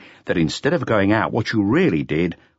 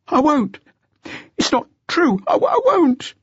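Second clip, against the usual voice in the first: high, louder, frantic refusal; panicked cry; 4 dB louder than usual. A narrator's reading. Jean refusing the inspector, panicked high cry. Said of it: "he cried".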